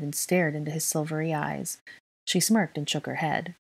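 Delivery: ordinary delivery, nothing marked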